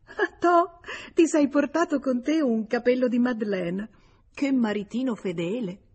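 mock surprise, high-pitched and laughing; teasing laugh. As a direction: tease him about his wife's hair, laughing. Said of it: "e si mise a ridere". (con tono canzonatorio, ridendo)

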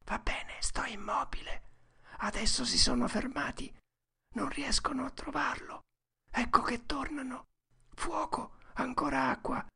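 high, breathy running commentary; whispering boy Tom. A narrator's reading. Tom follows the men's search, whispering. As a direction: whisper each development as you hear it.

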